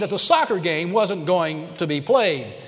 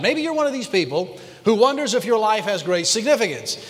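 ordinary talk

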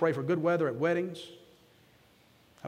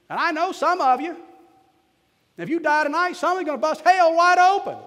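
high, loud country preacher's cry; preaching shout; 11 semitones higher, 9 dB louder than usual. Retold or imitated. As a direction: belt it out like a mountain lay preacher. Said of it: "I don't suppose I should preach like that here".

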